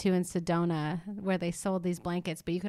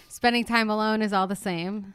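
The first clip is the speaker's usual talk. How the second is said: usual speaking voice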